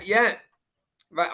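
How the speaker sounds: ordinary talk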